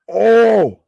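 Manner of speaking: loud, high "oh!"; big reaction cry at a wrestling hit; excited gasping "oh". Real, not acted. Fan watches a powerbomb land, loud excited "oh".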